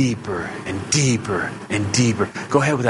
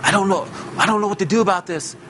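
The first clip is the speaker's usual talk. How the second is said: mock panic; 8 semitones higher, faster than usual. Panicked people phoning him.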